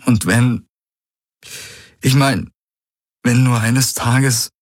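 slow, halting, voice trembling. Acted. Galen to Key about one day, shaky and slow. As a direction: let the words quiver and catch, slowly. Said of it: "Das Beben ging nun auch in seine Stimme über".

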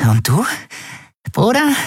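high, youthful dealer trying his luck; hopeful wheedle. A narrator's reading. Dealer turns to Kai, high and hopeful. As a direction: coax hopefully, leaping up at the end. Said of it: "Mit hoffnungsvoller Grimasse".

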